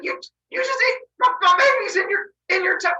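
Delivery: high loud stammering shock; shocked outrage. Acted. Mother shocked her husband ate the baby, high and loud. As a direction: splutter in disbelief. Rhea freaks out.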